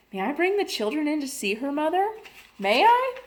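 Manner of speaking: put-on a little girl begging her mother; an excited daughter pleading with her mother; eager child. A narrator's reading.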